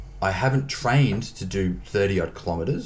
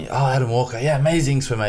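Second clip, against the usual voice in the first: enthusiastic; 7 semitones higher, 5 dB louder, faster than usual. Retold or imitated. Swim chief praising Adam.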